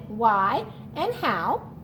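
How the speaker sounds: normal talking voice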